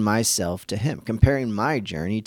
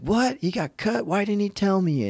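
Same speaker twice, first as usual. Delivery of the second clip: imitating the dad's shocked outburst, pitched much higher; a dad caught off guard, blurting out much higher; 11 semitones higher than usual. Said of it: "And he's like".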